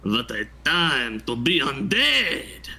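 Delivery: high, slow, weirdly German vampire. Pretend. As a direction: ham up a Count Dracula voice. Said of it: "give me your best vampire impression".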